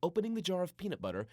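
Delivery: plain reading voice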